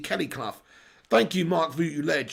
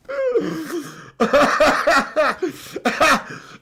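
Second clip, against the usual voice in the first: long helpless high laugh; big real belly laugh; 7 semitones higher, 3 dB louder than usual. Real, not acted. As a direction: crack up at the picture. Laughing at Mourinho picture.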